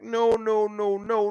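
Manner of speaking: usual speaking voice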